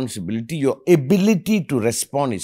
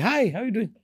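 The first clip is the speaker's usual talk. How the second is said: put-on bright, much higher friendly hello to a passing boy; cheery greeting; 9 semitones higher, 4 dB quieter than usual. Greeting a young boy by the lake, cheerful and high. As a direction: call out a sunny hi to a kid. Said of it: "I started like".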